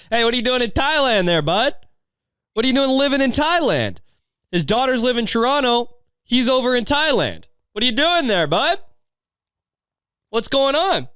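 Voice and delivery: high-pitched calling out; mock heckling. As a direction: call across the room, needling the guy. Acts out hailing the dad onstage.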